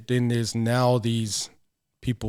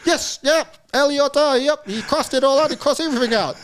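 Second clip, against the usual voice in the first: much higher, breezy confident voice; fresh manager; 12 semitones higher, 3 dB louder than usual. Retold or imitated. Manager on the phone confirming, breezy and high. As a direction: cheerfully confirm it, unbothered. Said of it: "really fresh and stuff".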